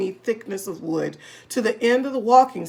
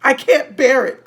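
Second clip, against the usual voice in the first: much louder, higher wail; anguished cry; 4 semitones higher, 9 dB louder than usual. A narrator's reading. Wife crying out in despair. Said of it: "cried Missus Twit".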